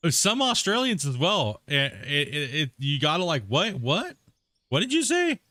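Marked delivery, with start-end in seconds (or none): 3.5-5.4 repeated questions climbing much higher; baffled listener. Acted. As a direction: play totally lost, pitch leaping up. Acting out trouble understanding Australians.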